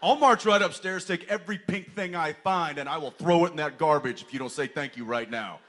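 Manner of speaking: loud, high mock-furious threat; stern threat. Dad scolding daughter about pink toys, hammed-up fury. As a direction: lay down the law, half laughing.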